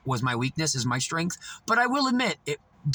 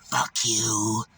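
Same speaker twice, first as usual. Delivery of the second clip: lower, flat imitation of her curse; low mock curse; 6 semitones lower than usual. Beggar cursing the narrator as she leaves, low growled curse. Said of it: "she went".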